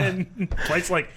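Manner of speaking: ordinary talk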